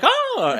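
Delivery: high loud fake scream of fright; mock shriek. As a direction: shriek like a horror heroine.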